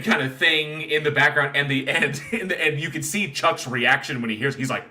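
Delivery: ordinary talk, nothing marked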